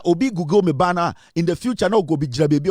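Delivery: normal talking voice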